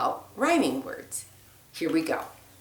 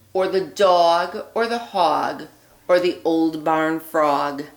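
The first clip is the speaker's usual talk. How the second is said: put-on lively read-aloud voice for children; storybook reading; 4 dB louder than usual. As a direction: read it like a bedtime story for little ones. Reads picture book aloud.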